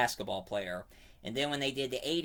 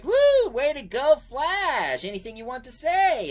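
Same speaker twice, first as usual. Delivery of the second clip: much higher and louder whooping fan; cheering crowd; 11 semitones higher, 9 dB louder than usual. Retold or imitated. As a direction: cheer him on like a fan at the riverbank.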